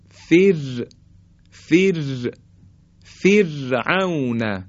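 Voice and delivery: فرعون recited slowly, thin held R after فر; light R. Shown as a demonstration. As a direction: keep the R in فر light, then stretch فرعون. (فرعون با «ر» نازک و کشیده)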